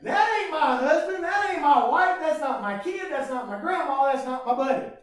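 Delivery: high, loud, mock-triumphant cry at a funeral; mock triumphant mourner. Mourner at a funeral, mock-triumphant, laughing. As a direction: ham up a mourner gloating over death. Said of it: "walks in and goes".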